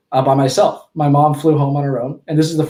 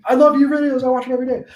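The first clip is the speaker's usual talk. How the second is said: high, breathless fanboy voice; gushing young fan; 9 semitones higher, faster than usual. Retold or imitated. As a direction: gush like a starstruck kid. Retelling fan message as kid.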